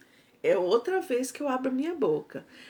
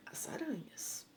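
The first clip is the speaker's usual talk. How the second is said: breathy whisper of the answer; 4 semitones higher, 16 dB quieter than usual. Shown as a demonstration. Teacher to pupils, whispering the answer. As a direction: let the answer slip out in a whisper.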